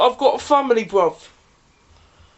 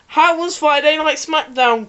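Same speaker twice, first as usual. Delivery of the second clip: ordinary talk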